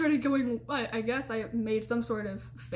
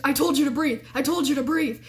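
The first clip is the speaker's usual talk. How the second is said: punched, louder impatient retort, imitating boyfriend; irritated; 3 semitones higher, 4 dB louder than usual. Irritated boyfriend to panicking girlfriend, impatient scolding. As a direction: hit each word in mock exasperation. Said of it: "he's starting to get irritated".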